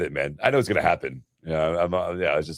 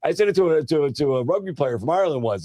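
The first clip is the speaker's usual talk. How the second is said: excited; 8 semitones higher than usual. Retold or imitated. Retells his own reasoning, laughing.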